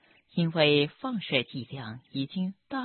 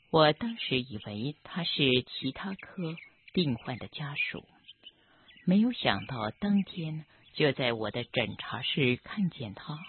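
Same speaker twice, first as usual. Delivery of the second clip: plain reading voice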